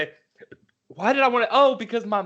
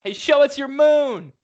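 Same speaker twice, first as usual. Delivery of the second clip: loud, slow mock yell; a hammed-up jeering shout; 10 semitones higher, 6 dB louder, slower than usual. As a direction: call it out across the bleachers. Retold shouting at cousin.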